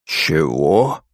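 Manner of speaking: low, slow, rising question; baffled rumble from a big dragon; drawn-out low "what?"; dumbfounded dragon. A narrator's reading. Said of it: "даже разинул пасть от удивления дракон".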